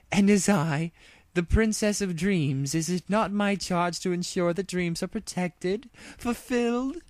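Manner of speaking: high, lofty regal declaration; grand princess. Acted. Luna to Applejack and Rainbow Dash, proclaiming her charge. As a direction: proclaim your royal duty.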